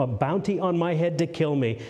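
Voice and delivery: ordinary delivery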